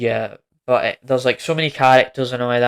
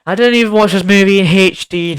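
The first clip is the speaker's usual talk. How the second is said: louder, higher insistent repeat; repeating the point louder to stress it; emphatic repeat; 7 semitones higher, 7 dB louder than usual. Real, not acted. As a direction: say it again with a push. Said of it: "And I didn't even watch this movie in H D".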